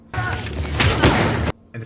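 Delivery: slow, weighty pause-laden delivery; dramatic slow. Real, not acted. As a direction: make it a grand pronouncement.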